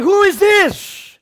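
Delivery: high, loud and slow, startled; startled cry. Acted. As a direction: ask it in alarm, high and drawn out. Startled man asks.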